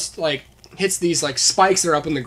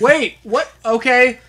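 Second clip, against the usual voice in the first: higher and louder, a hammed-up baffled "wait, what?"; mock confused surprise; 8 semitones higher, 7 dB louder, slower than usual. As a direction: play the kid thrown off, then giving up. Confused kid in the scene.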